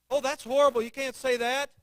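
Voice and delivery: usual speaking voice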